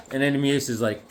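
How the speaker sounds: ordinary talk, nothing marked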